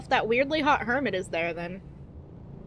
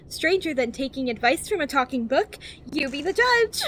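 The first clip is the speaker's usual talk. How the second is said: over-enunciated guidebook narration, lilting up and down; cheery infomercial voice. Acted. Reading a survival guide to new players, cheery infomercial voice. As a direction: read it like a relentlessly positive infomercial. Reads the game's guide book.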